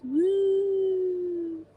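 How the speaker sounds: a high ironic woo; fake-excited woo; mock cheer. Real, not acted. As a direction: cheer sarcastically. Mock cheer at fish and chips.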